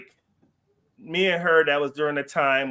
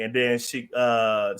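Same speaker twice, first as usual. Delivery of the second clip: usual speaking voice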